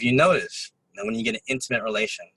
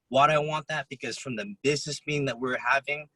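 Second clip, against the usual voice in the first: usual speaking voice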